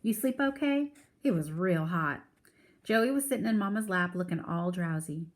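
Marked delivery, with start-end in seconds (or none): 0.0-2.2 grandma voice. Acted. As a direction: play a kindly grandmother. Grandma Sands greets Kenny.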